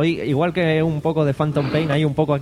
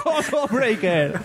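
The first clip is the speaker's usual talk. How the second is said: fighting game announcer; 6 semitones higher, slower than usual. Pretend. Gaming joke after cockroach kill.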